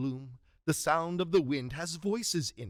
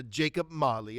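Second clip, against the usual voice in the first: ordinary talk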